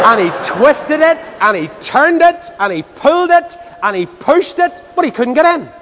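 high, drawn-out stress on "twisted", "turned", "pulled", "pushed"; straining effort. Retold or imitated. Buyer struggles to get cupboard indoors, "twisted", "turned", "pulled", "pushed" each strained high.

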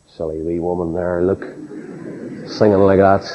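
low, quiet imitation of a sneering aside; mock her under your voice, pitched low. Student beside a singing old lady, imitated mocking. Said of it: "And they mock her".

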